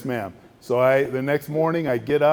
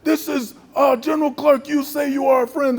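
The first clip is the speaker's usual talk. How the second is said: much higher and louder, shouting accusations; furious shouting general; 13 semitones higher, 5 dB louder than usual. Retold or imitated. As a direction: rage at the friend who betrays him. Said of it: "And then he goes".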